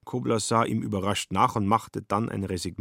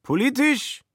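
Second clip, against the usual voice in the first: put-on drunk Schmitz; 5 dB louder than usual. Drunk Schmitz questions Kobler.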